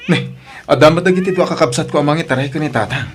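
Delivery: loud, high, indignant outburst; loud protest. Acted. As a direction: burst out against the neglectful siblings. Angry at absent siblings.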